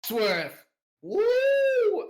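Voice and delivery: put-on high, loud drunken cheering; boisterous tipsy send-off; rowdy drunk. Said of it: "there are two drunk men talking to him".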